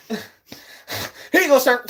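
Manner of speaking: high, slow, laughing service voice; stretched high customer service voice, laughing; mock drive-thru server. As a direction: hand it over with a squeaky laugh. Playing drive-thru worker.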